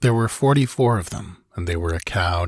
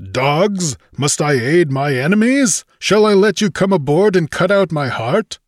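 raised, challenging Conan voice; mild roar; 9 semitones higher, 5 dB louder than usual. A narrator's reading. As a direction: silence the rabble with a restrained roar. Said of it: "silenced with a lion-like roar".

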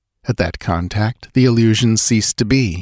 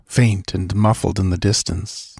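usual speaking voice

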